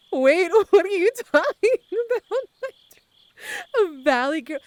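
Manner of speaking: loud, high, laughing protest; laughing disbelief. Real, not acted. Streamer accused of a Valley Girl accent, laughing disbelief. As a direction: protest loudly while cracking up.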